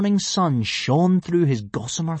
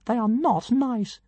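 much higher, slow and grand; pompous tiny dragon; 12 semitones higher, slower than usual. A narrator's reading. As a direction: announce yourself like a pint-sized deity.

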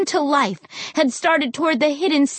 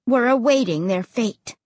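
usual speaking voice

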